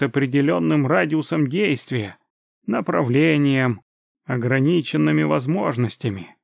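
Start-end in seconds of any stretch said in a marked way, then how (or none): none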